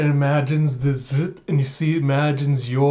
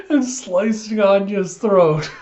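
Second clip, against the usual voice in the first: laughing while talking; 7 semitones higher than usual. Real, not acted.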